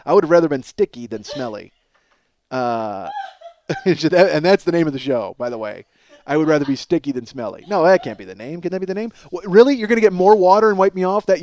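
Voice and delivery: ordinary talk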